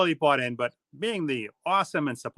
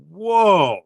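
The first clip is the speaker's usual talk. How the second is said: amazed whoa; 3 semitones higher, 8 dB louder than usual. Retold or imitated. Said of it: "I'm like".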